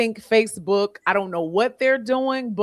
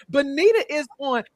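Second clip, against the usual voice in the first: ordinary talk, nothing marked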